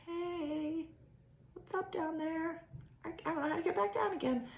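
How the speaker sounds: soft, floaty voice of someone lost mid-trip; high-flying tripper. Retold or imitated. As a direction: call up dreamily from way up high. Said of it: "I can be like".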